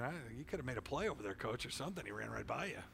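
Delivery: soft, fast muttered retort; sulking under breath; inner retort. Retold or imitated. Teen silently answering coach, fast soft retort. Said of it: "I'm thinking".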